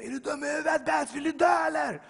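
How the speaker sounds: high, loud police yelling; shouting. Retold or imitated. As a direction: yell it as an outraged officer piling on. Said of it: "de står och skriker". (skrikande, upprörd polis)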